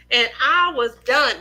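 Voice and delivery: usual speaking voice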